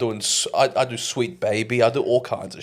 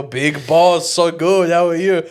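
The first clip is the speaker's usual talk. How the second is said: louder, higher cheerful reply; cheery reply; 7 semitones higher, 5 dB louder, slower than usual. Retold or imitated. Answering the store clerk's greeting, loud and cheerful. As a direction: return the greeting with gusto. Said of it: "And I go".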